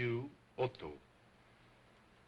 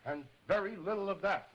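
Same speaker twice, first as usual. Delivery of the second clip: higher, stern demand; 7 semitones higher, faster than usual. Acted. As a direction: order silence sternly. Scolding dummy.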